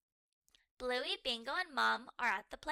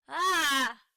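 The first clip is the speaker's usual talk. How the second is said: startled yelp; 3 semitones higher, 12 dB louder than usual. Acted. Terrier fleeing.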